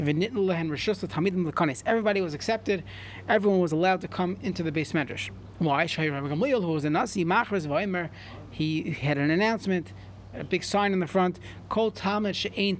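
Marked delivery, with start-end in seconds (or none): none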